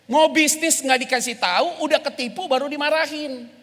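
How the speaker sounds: loud, high preaching at full force; fiery preaching. Acted. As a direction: preach it hard to the congregation.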